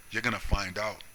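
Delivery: ordinary talk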